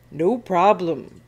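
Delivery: loud, slow monster reply; booming monster. A narrator's reading. Sea monster answering the captain's thanks, booming. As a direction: boom it out slowly. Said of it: "booms the monster".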